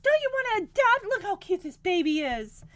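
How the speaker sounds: high, eager imitated coaxing; mock-gushing wife; gushing over a baby. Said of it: "Becky's just having a fun time".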